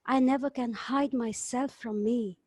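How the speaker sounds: ordinary delivery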